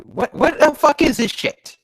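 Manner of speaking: high, loud exasperated outburst; outraged disbelief. Real, not acted. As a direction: burst out in baffled outrage. Reader outraged at the bad story.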